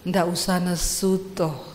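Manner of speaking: low, soft Javanese husband; mild husband. Acted. Solo husband answering his shouting wife, low and mild. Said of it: "Dia datang gini".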